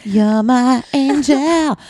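slow, high, syrupy mock swoon; mock lovestruck. Reading her imagined note to the nurse, slow and swooning. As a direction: draw it out like a soppy love note.

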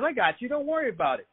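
put-on calm the kid down gently; reassuring. Coworker reassures the narrator.

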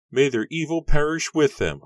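high, loud bitter declaration; loud curse. Acted. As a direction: call down a curse. Villager cursing the Fears.

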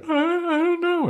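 very high falsetto, hesitant; high falsetto Willow. Retold or imitated. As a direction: squeak it out unsure like a young girl. Voicing Willow unsure.

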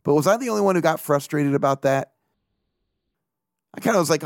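usual speaking voice

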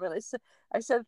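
ordinary delivery

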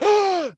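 high-pitched startled "Ah!"; mock startled gasp. Everyone as the bushes rustle, high mock-scared gasp. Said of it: "everyone's like".

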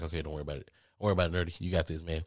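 low, soft pep talk to himself; calm yourself down quietly; self-reassurance. Retold or imitated. Reassuring himself while sick.

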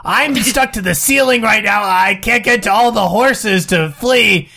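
high, pleading put-on voice; distressed trapped character. Character stuck to the ceiling reporting horrors, distressed.

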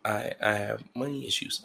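soft, halting excuse; hushed, hesitant role-play; imagined partner making excuses. Acted. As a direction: stammer it out quietly, reluctant to admit it. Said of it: "And you say".

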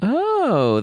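high bright "oh"; delighted oh. Real, not acted. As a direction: exclaim with delight.

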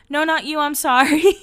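a touch of embarrassed Lauren; flustered mock apology. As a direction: blurt the correction as Lauren.

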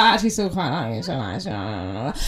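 low, very soft admission trailing away; smitten confession. Acted. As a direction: murmur it low, giving in. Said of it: "and it's like".

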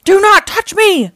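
high, loud indignant roar; fearsome alien outburst. A narrator's reading. Alien to Leo who bumped him, angry roar. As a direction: bellow it high, word by word. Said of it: "replied the fearsome creature".

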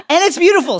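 high, loud swoon over a beautiful love; dreamy high declaration of love. Pretend. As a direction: gush it out like you're in love.

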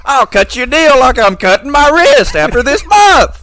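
high, loud dark pitch; hammed-up pitchman. Friend parodying a dealer's ad, loud and high. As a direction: sell it like a dealer at the edge.

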